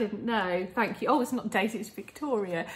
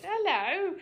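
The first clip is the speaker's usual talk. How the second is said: high greeting; 10 semitones higher than usual. Real, not acted. Greeting the dog.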